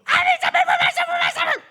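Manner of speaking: very high, very loud screech; old woman yelling. Acted. Old lady screaming at the grocer, very high and loud. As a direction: bellow at the locked door. Loudest knocking, shouting.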